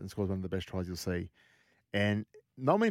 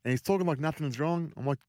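normal talking voice

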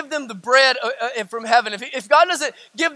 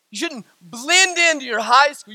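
ordinary talk

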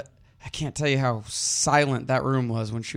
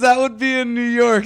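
higher, louder put-on voice; mocking imitation; 9 semitones higher, 5 dB louder than usual. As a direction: parrot her answer in a silly voice. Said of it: "She goes".

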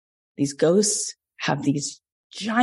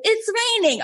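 high, whiny mock alarm at light rain; mocking LA people. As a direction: make fun of them being dramatic about drizzle. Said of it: "L A people are like".

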